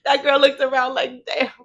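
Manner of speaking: laughing. Real, not acted. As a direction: giggle while telling the joke. Joking about Belly's sadness.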